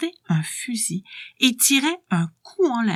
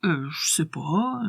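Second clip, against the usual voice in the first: hesitant whisper; 5 semitones lower, 4 dB quieter, slower than usual. Acted. Hesitant reply to odd question.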